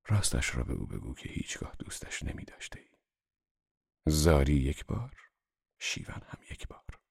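low, hushed, grave male figure; quietly resolute, pitched well down; firm gravity. A narrator's reading. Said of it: "با صلابت گفت". (با صلابت، بم و آهسته)

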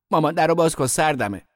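high, loud plea; calling out pleadingly. A narrator's reading. Boy locked in storeroom to mother, pleading call. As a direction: call through a shut door, loud and high.

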